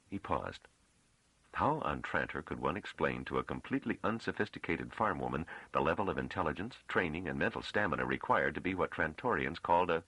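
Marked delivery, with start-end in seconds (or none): none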